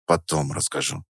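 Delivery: low, soft grumble; grudging, quiet and low; grumbled. A narrator's reading. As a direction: brush them off in a low mumble. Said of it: "буркнул я".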